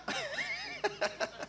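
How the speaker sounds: a quiet high chuckle; soft high laugh. Real, not acted. Preacher chuckling at his own story, high and soft. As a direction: giggle under the breath.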